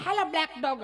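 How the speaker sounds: lady calling the dog shrilly; high call. Retold or imitated. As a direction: call hello black dog high and loud. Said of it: "આવું black dog".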